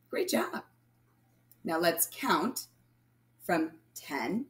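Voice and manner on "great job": whispered praise. Real, not acted. Praising children after counting.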